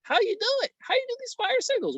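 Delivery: very high, squeaky mock question; put-on high-pitched fan voice; imitating curious viewers. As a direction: mimic amazed viewers in a high falsetto. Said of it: "some of y'all like".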